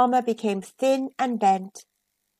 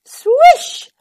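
high loud swish; loud whoosh; 11 dB louder than usual. A narrator's reading. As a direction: sweep the word through. Sound effect word.

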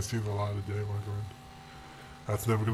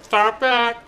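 high squeal; 11 semitones higher, 9 dB louder, slower than usual. Acted. Gamer blurting a silly high squeal at a crash. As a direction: Throw it back shrieked high, loud and slow. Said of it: "why did I say that?".

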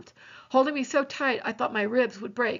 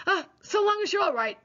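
relieved, near tears; 9 semitones higher than usual. Acted. Relieved Reverend, voice catching.

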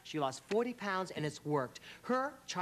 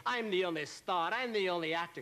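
obnoxious star boast. Retold or imitated. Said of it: "really kind of eccentric and obnoxious".

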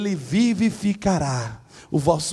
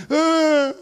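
mock wail; 10 semitones higher, 6 dB louder than usual. Child falls and cries.

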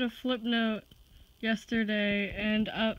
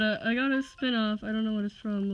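ordinary talk, nothing marked

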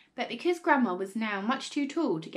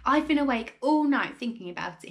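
loud, raised excited cry; excited father, louder and higher; great excitement; 4 semitones higher, 6 dB louder than usual. A narrator's reading. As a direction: burst out with excitement. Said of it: "in a state of greater excitement than ever".

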